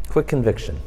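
low, soft repeat of the phrase; quiet low echo. Shown as a demonstration. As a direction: echo it quietly, dropping the pitch. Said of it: "You want a quick conviction?".

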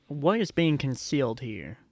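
ordinary talk

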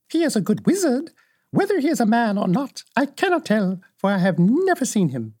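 put-on high-pitched old witch voice; frank old witch. Old witch answering Dorothy about the wizard, high witch voice.

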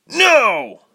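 high, loud, piercing No; shriek. Acted. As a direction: shriek it out in fury. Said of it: "Tyke shrieked".

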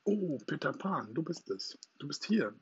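joyful call. A narrator's reading. Wendy wakes and finds Peter Pan there, calling out joyfully. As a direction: greet him in joyful surprise. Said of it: "Freudig rief sie".

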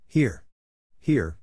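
cheering call, punched first word; crying out. A narrator's reading. Club member cheering the speech, crying out. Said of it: "cried Joe".

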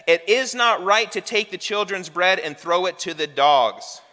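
stern rebuke. Acted. Jesus testing the disciples' prejudice, loud stern saying. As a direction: lay the harsh words down hard. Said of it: "you've got to know when he makes this statement".

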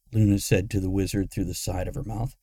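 plain reading voice

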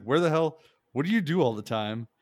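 loud imitated snap at a reporter; mock snap. Retelling Biden snapping at a CNN reporter, mock angry. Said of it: "snapped at her".

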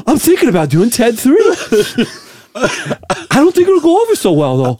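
high, out-of-breath actor impression; Mark Wahlberg. Host musing as a movie star, breathless Wahlberg voice. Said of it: "have to act out of breath".

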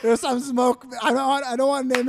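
a real laugh breaking in. Real, not acted. Laughs while talking about hot girls.

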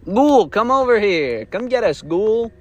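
yelling across the woods to the ghoul; calling out. Retold or imitated. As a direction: call out to lure the ghoul. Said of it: "you start going".

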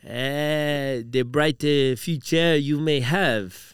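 slow, hammed-up French accent; light French accent, slow; mock French accent. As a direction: drag out a playful French accent. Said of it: "Do French. Let me try it".

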